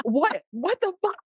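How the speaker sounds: very high, outraged shriek of disbelief; can't believe what you just heard; stunned disbelief. Retold or imitated. Told chips went in the toilet, high stunned disbelief.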